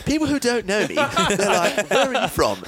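high-pitched mock British accent; put-on British accent. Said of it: "I'll get the accent right".

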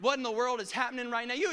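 usual speaking voice

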